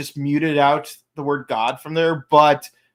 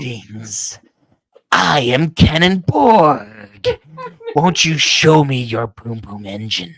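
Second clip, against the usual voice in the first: low slow robotic villain voice; hammed-up robot villain introduction; 4 semitones lower, 4 dB louder than usual. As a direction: announce yourself like a cartoon robot. Impersonating robot villain Cannonborg.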